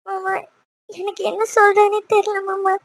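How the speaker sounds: tearful, at a loss for words; acted tearful wife; crying. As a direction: say it through tears to your husband. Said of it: "அதுக்காக ஏன் இப்படி அழுதுட்டு இருக்க".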